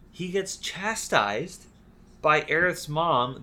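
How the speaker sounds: ordinary delivery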